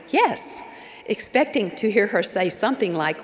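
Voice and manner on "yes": bright upward leap on a cheerful reply; retold happy, eager reply; happy answer. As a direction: chirp it happily, pitched high. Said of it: "I answered happily".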